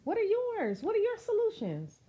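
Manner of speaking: ordinary delivery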